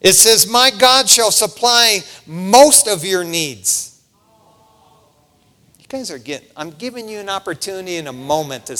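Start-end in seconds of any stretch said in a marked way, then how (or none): 0.5-4.0 "most" hit loud in a slow mock misreading; slow teasing misquote with "most" punched; stress on most. Shown as a demonstration. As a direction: lean hard on "most" as the wrong word. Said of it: "Is that what it says?".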